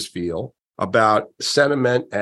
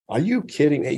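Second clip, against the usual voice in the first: stunned disbelief; 4 semitones higher than usual. Retold or imitated. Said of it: "look at the phone like".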